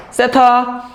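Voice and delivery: 세타 drawn out with deliberate emphasis; stressed 세타. Shown as a demonstration. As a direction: demonstrate an exaggerated accent on 세타. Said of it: "여기 강세 있어요".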